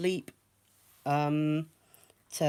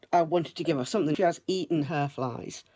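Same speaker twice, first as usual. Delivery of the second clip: ordinary delivery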